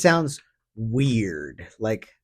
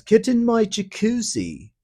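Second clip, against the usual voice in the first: high, mocking sleazy old man voice; hammed-up creep inviting a girl into the tub; disgusting old man; 11 semitones higher than usual. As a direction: sneer it like a lecherous old man. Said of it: "Disgusting old man says".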